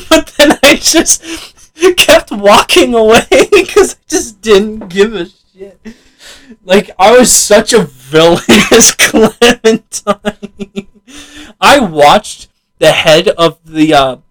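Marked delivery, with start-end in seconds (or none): 0.1-5.8 high-pitched, laughing confession; laughing while talking. Real, not acted. Laughing at own story. 6.6-10.9 slow, higher voice breaking into laughter; gleeful and half laughing; laughing confession. Real, not acted. Laughing at own villainy.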